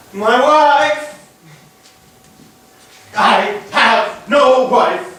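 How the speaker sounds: high, loud outcry of grief, slowing at the end; a husband's anguished howl; anguished cry. Acted. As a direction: cry it out as the truth hits. Husband grasps wife is dead.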